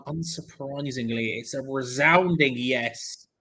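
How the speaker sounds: dragged-out result reveal; slow, drawn-out announcement of the result. Real, not acted. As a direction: stretch it out like a verdict. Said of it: "announcing poll result".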